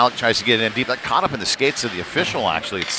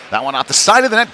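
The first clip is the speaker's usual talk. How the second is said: excited call; 9 semitones higher, 6 dB louder, faster than usual. Real, not acted. Near miss on shot.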